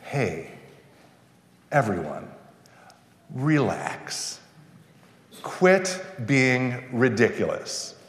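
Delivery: soft, slow and soothing; calming people down. Retold or imitated. Prophet calming the people down, soft and soothing. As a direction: gently settle a restless crowd. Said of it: "trying to sort of calm people down".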